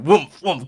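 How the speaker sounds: loud booming woomph sounds; mock coffin thuds; coffin thud noise. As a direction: thump it out like a heavy coffin hopping.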